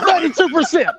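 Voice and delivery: said through laughter, high and loud; gloating laugh at a friend's admission. Real, not acted. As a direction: laugh the number out in disbelief. Laughing at the ninety-two score.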